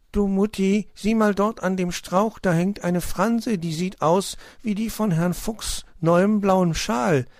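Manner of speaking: high, loud little hedgehog calling out; excited. A narrator's reading. Child showing mother a clue, excited. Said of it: "rief Borstel aufgeregt".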